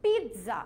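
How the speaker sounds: slow pizza as peet-za; correct pronunciation. Shown as a demonstration. Teacher confirms the correct answer, pizza as slow peet-za. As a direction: give pizza a clear t before za. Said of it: "So the correct answer is".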